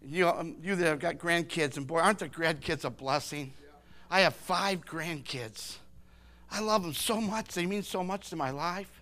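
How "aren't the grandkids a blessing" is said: ordinary delivery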